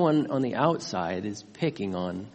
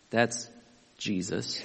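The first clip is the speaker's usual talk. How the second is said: usual speaking voice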